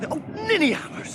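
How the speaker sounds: high, loud cry of self-reproach; exasperated cry. Acted. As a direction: yelp at your own foolishness. Sam realises rope left behind.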